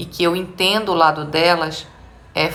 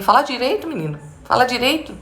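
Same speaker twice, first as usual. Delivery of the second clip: higher-pitched mock scolding; scolding mother; 6 semitones higher than usual. Mother turns to her child, mock scolding. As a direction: imitate a mother telling off her boy. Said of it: "vai virar pra mim".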